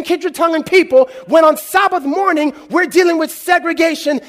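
high, loud, building sermon cry; full-force preaching. Acted. Preacher challenging segregation in church, fervent preaching. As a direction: preach it hard to the congregation.

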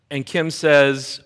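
usual speaking voice

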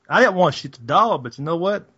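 normal talking voice

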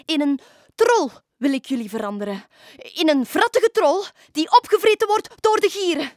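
loud, rising witch's curse; angry witch. Acted. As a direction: hurl a threat like a furious witch. (woedende heks)

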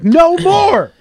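slow, high and loud declaration; telling his wife after waking up, mock yell. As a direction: bellow it like a stoned vow. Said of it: "I was like".

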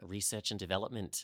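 quiet put-on Australian accent. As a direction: slip it in softly, in an Australian accent. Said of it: "which is my Australian accent".

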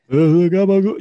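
hammed-up Italian-American outburst; mock Italian-American accent. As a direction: belt it out as a cartoon Italian-American. Echoes mock Italian, laughing.

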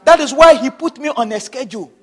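normal talking voice

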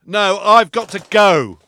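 slow, strained, desperate; desperate to get to the toilet; urgent need. Acted.

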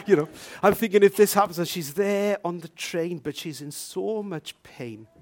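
ordinary delivery

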